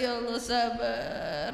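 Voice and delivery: slow, drawn-out long-suffering sigh; slow weary prayer for patience; exasperated sigh for patience. Retold or imitated. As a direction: stretch it out like a worn-out mother. Mother sighing for patience.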